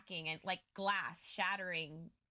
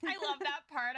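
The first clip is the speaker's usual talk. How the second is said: ordinary delivery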